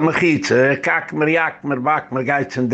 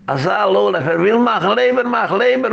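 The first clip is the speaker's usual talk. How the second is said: mocking; 7 semitones higher than usual. Mocking the lowlife's liver plan.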